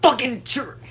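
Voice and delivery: mock New York accent; a quick cursing New Yorker; a hint of a Brooklyn accent. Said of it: "I'm better at accents".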